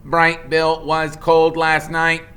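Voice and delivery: a calm robot stating a fact. Acted. The robot Roz states it plainly, a level machine voice.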